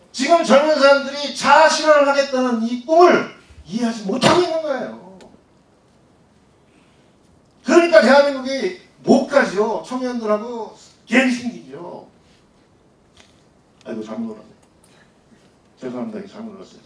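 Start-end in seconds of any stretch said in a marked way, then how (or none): 0.1-5.2 real impassioned lecturing, loud and high; worked up. Real, not acted. Lecturer defending young people's dreams, genuinely worked up. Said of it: "흥분해가지고". 7.6-12.1 loud, raised, impassioned conclusion; worked up. Real, not acted. Lecturer blaming the generation gap, genuinely worked up. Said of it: "흥분해가지고".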